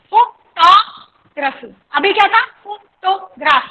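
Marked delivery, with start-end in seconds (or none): 0.1-1.7 photography slowly as fo, TOG, ruh-fee; syllable by syllable. Shown as a demonstration. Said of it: "चार syllables है". 2.6-3.6 photograph slowly as fo, to, graf; syllable by syllable. Shown as a demonstration. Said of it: "तीन syllable".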